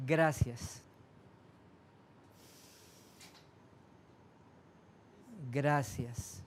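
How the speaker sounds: gracias said low and soft, falling. Shown as a demonstration. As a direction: breathe out a gentle gracias. (gracias suave y bajo)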